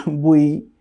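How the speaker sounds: each syllable drawn out slowly; slow, careful syllable drill. Shown as a demonstration. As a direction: stretch every sound for the learner. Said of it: "descending স্টাইলেও পড়তে পারেন".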